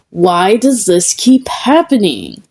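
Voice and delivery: ordinary delivery, nothing marked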